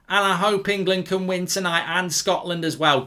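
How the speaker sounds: ordinary talk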